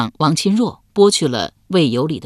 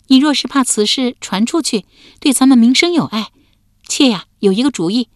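put-on young woman voice; 7 semitones higher, 4 dB louder than usual. A narrator's reading. Wife's voice.

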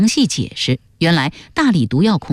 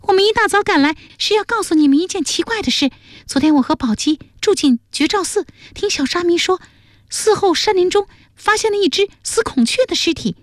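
much higher, put-on female voice; high-pitched, eager girl's voice; excited young woman, winded; 12 semitones higher than usual. A narrator's reading. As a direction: report breaking news after rushing over. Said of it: "气喘吁吁地说".

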